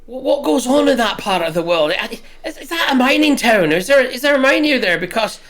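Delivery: a barkeep slipping into an Irish lilt; Irish accent. Acted. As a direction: play it with a light Irish accent. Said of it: "I've gone Irish from Scottish".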